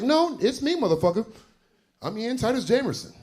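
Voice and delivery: high-pitched mock exasperation; acted-out indignant self-introduction; indignant retort. Acts out his retort to interviewer.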